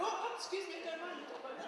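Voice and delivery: high, fussy apologetic voice; flustered old lady. Retold or imitated. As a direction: dither politely like a confused pensioner. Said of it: "Somebody else going".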